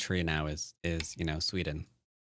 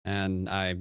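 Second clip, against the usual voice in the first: usual speaking voice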